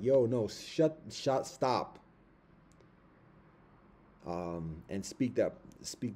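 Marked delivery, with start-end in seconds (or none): 0.0-2.0 loud, sharp command to stop; sharp yell to stop. Retold or imitated. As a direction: cut them off hard, shouting. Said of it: "feeling like".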